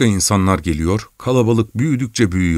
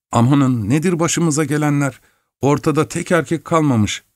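higher-pitched, mildly panicked grumbling; fearful lament; 6 semitones higher than usual. A narrator's reading. Frightened woman bewailing that no man is left around, fearful lament. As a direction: voice a frightened woman fretting aloud. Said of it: "bir kadının korkulu sesini".

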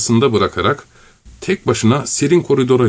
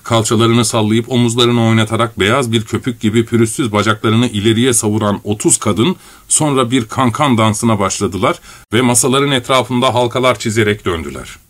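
ordinary delivery, nothing marked

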